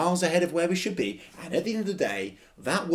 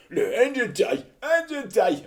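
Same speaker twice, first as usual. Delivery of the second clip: loud, high London bloke; worked-up London punter, pitched high; London accent; 11 semitones higher, 7 dB louder than usual. Retold or imitated. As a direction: put on an irate London accent. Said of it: "why I gave him that London accent".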